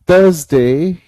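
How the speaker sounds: "थर्सडे" as THUHZ-day, no R sound; silent R. Shown as a demonstration. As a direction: model "थर्सडे" for a class, rising on the last syllable. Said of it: "थर्सडे में आर की sound नहीं आएगी".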